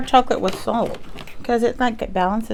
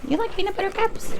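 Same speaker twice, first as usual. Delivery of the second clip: pet talk; 11 semitones higher than usual. Real, not acted. Teasing the pet with candy.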